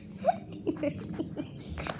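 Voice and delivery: quiet helpless giggle; stifled breathy laughing; breathy laughter. Real, not acted. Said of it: "I'm still laughing".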